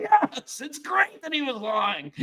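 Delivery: very high, delighted voice; gleeful reply. Retold or imitated. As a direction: sound thrilled by the lie. Host's delighted sarcastic reply.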